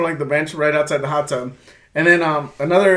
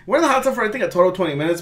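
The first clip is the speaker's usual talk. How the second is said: usual speaking voice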